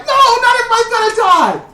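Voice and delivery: high, fast, loud protest; hammed-up outrage; squeaky mock indignation; mock pleading. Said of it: "And I'd be like".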